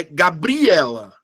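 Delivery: "Gabriela" slowly, with BRI punched and stretched; misplaced stress on the middle "bri" of "Gabriela"; stress on second syllable. Shown as a demonstration. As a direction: hit "bri" hard in "Gabriela". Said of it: "qual é a sílaba que eu falo mais forte?".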